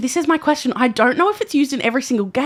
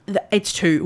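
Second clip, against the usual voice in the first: normal talking voice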